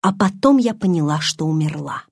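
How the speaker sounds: plain reading voice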